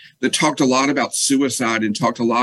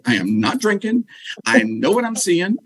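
retold desperate pleading; 7 semitones higher than usual. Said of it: "I was just screaming into the phone".

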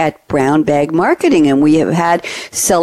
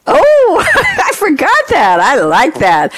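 delighted laugh; 10 semitones higher, slower than usual. Real, not acted. Delighted by yogurt shop name.

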